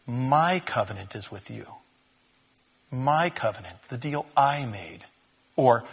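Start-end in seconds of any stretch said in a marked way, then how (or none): 0.1-3.8 "my" leaned on, stretched ahead of "covenant"; stress on MY. Shown as a demonstration. Preacher tries the emphasis on different words, stressing "my". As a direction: put the weight on "my". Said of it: "try the emphasis on different words".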